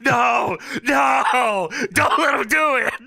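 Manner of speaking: shouting at the screen. Real, not acted. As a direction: yell at the TV for them to stop. Cheering at a match on screen.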